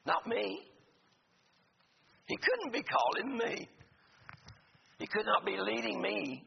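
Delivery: Moses doubting himself. Retold or imitated. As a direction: plead it as a man sure he isn't the one. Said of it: "they may think like Moses thought".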